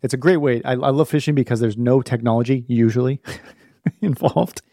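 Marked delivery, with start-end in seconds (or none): 3.6-4.6 laughing. Real, not acted. Laughing at own fishing obsession.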